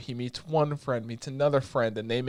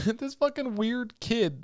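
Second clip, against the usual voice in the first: ordinary delivery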